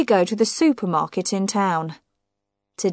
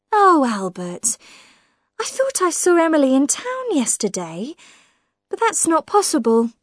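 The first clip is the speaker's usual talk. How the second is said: high, probing voice of a suspicious woman; prying sister-in-law; 8 semitones higher than usual. Acted. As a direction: needle him with pointed questions. Millicent voiced in the story.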